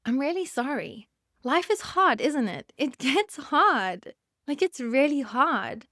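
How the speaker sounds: real tears, words stretched and wavering; fighting back tears, slowly; choked-up sympathy, breaking on hard. Real, not acted. Speaker tearful, voice breaking.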